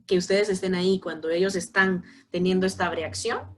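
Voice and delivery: usual speaking voice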